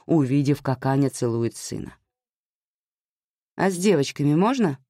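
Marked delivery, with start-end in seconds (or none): 3.5-4.8 sharp defiant retort; snapping back. Acted. Anya snaps back at her mother, defiant. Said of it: "огрызнулась она".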